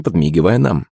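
usual speaking voice

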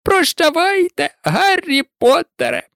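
very high elf voice, rising goodbye; fond house-elf farewell. A narrator's reading. Dobby saying goodbye to Harry, fond.

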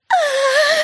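very high, sustained scream. A narrator's reading. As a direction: scream out on one long high note. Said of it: "заорала Энн".

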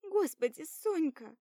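high loud sobbing cry; tearful cry. Acted. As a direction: cry out the name through tears. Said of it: "Светка плачет".

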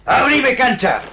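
shouted demand to clear the way; commanding shout. Acted. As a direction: shove them aside with your voice. Villain demands passage.

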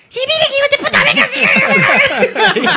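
high, loud, laughing panicky shout; panicked guard impression. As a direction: squeal it out through laughter. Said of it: "dicen que el mismo guardia".

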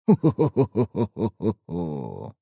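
low, slow sinister ho-ho chuckle; unpleasant laugh. Acted. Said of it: "Засмеялся покупатель неприятным смехом".

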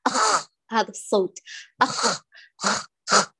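أخ with a rattling, snore-like throat; snoring kha. Shown as a demonstration. Teacher imitating the excessive snoring kha. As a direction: rattle أخ too hard like snoring. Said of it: "صوت الشخير".

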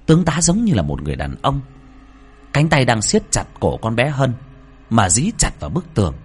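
ordinary delivery

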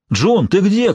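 shout. A narrator's reading. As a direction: call out searching for her. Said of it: "крикнул Лоренс".

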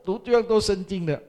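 high, loud gushing wow; mock amazed praise. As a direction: gush over him like an amazed friend. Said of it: "哇，你们懂啊". (高声夸张地惊叹赞美)

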